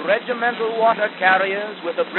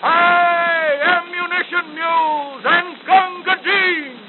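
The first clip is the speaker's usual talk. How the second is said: high, slow battlefield yell; front-line shout; 10 semitones higher, 5 dB louder, slower than usual. Acted. Front file yelling for ammunition in battle, loud. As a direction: shout it over the gunfire. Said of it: "you could hear the front file shout".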